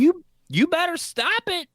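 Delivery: high, loud mock scolding; Natalie. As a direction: imitate a bossy producer issuing a warning. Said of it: "That's gonna be Natalie".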